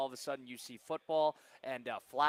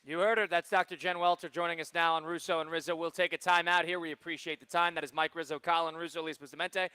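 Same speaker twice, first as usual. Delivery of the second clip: louder, projected sign-off; radio host outro; 4 semitones higher, 7 dB louder than usual. Real, not acted. Host closing the interview for a time out, radio outro. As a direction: punch it out to break, louder on the sponsor.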